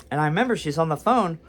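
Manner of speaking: ordinary delivery, nothing marked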